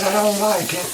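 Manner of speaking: high, whiny little monkey complaint; voicing the monkey. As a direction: pipe up like a pet refusing its bath. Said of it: "He says".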